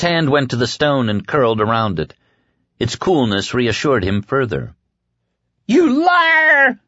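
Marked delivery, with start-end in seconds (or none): 5.7-6.8 high, loud scream; screamed accusation. A narrator's reading. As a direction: scream it in frantic fury. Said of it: "Heck screamed".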